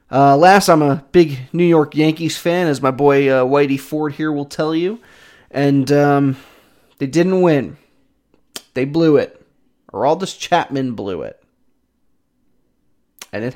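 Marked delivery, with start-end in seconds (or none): none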